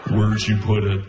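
low, creepy sleep-talker voice; Buffalo Bill. Retold or imitated. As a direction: drop it low like a movie killer. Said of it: "Why do you sound like Buffalo Bill?".

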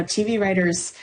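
normal talking voice

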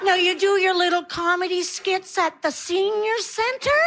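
patronizing. Retold or imitated. Audience member to older comedian, patronizing. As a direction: humour her as if she were a child.

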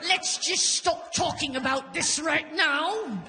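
hammed-up British period-film actress; Keira Knightley. Comic telling of holding back emotion, tight-jawed actress impression. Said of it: "sounding like Keira Knightley".

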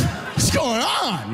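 high, slow stretched-out protest; mock bewildered yell. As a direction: cry it out dragged along. Being escorted out.

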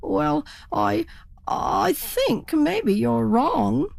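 a lightly voiced unsure turkey; hesitant turkey. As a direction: doubt yourself while insisting. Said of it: "said the turkey".

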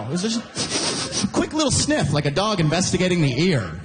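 ordinary talk, nothing marked

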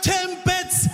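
high, flat insistence on the word; impatient customer. Retold or imitated. Annoyed customer insisting on the word, high and impatient. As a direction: repeat it as if talking to a child.

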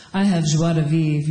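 put-on mild French accent. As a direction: suave French guy at a party, French accent. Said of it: "a French guy says".

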